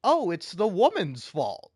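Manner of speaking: a hammed-up sneer at the comments; mocking commenters. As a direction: parody the dumb comment with scorn. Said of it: "every motherfucker in the comments is like".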